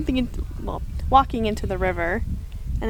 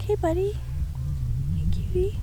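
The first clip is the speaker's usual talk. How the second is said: put-on cooing baby talk; 6 semitones higher than usual. Cooing at a passing beaver.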